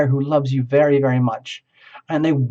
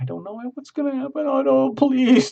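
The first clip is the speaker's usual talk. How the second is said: much higher, hammed-up fretting; mock panicked partner; 10 semitones higher than usual. Partner on the shore fretting over the surfer, mock panicky high voice. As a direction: squeal like a nervous partner watching from shore. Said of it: "They're way over here like".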